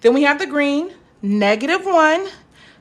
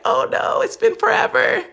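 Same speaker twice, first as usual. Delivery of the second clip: high, mock alarmed wail; mock panicked parent; 10 semitones higher than usual. Parent asked for math help by a kid, mock panicked and much higher. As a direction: play the parent caught out by homework. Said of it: "And you're like".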